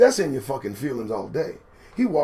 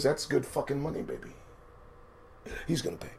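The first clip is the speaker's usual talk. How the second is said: ordinary talk, nothing marked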